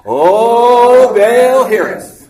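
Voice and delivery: loud, high wailing plea to Baal; revival cry. Acted. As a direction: lead the crowd in the cry. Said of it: "Everybody say it".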